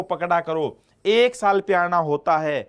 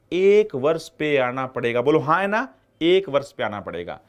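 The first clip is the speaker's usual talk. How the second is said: usual speaking voice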